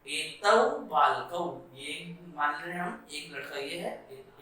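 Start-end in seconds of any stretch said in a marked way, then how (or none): 0.1-1.4 तौ बालकौ said slowly and clearly; slow Sanskrit demo. Shown as a demonstration. Teacher repeating the dual form for students. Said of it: "द्विवचन क्या होता है".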